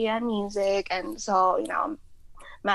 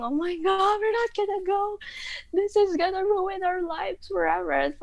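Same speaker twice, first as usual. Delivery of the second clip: high, hammed-up teen wail; mock wailing; 11 semitones higher than usual. Girls crying over missing the concert, hammed-up wail. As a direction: overplay the heartbreak, high and pleading. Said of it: "We were so dramatic".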